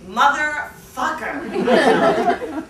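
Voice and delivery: slow, loud, drawn-out curse; frustrated curse. Acted. Seeing the roommate's light on, cursing loudly.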